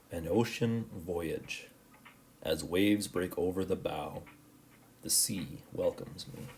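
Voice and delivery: slow, soft poem reading; haiku reading. Shown as a demonstration. Teacher reading a haiku to the class, slow and soft. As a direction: read the haiku slowly and gently.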